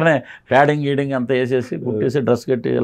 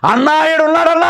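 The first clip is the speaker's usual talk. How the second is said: much higher, louder excited call; excited fan yelling; 5 dB louder than usual. Retold or imitated. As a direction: yell to Harikrishna that you've spotted him. Fan spots him, calls out. (అభిమాని హరికృష్ణను పిలుస్తూ ఉత్సాహంగా గట్టిగా అరుపు)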